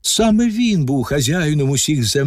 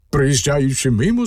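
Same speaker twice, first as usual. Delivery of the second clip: plain reading voice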